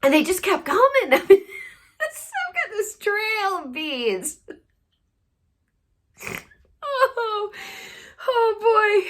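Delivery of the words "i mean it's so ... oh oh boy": high, teary laughter breaking the words; laughing so hard it's almost crying. Real, not acted. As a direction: laugh until you can barely speak. Overcome by laughter recounting.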